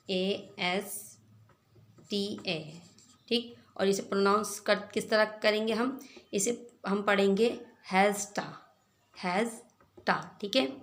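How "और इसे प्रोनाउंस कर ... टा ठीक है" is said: normal talking voice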